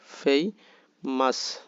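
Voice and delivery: ফেমাস slowly split into ফে and মাস; two syllables. Shown as a demonstration. Teacher shows ফেমাস in two parts, slowly separated. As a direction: leave a gap inside ফেমাস. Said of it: "দুই ভাগে".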